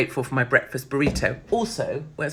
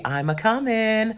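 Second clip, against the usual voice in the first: higher, slow camp sing-song; 7 semitones higher, slower than usual. Pretend. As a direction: camp it up coyly. Camp reply to an invitation.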